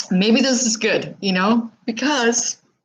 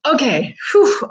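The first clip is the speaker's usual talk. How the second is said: a high, loud whew to steady herself; real relief after crying; composing herself; 7 semitones higher, 3 dB louder than usual. Real, not acted. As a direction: shake off the tears and reset. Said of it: "now I have it pulled together".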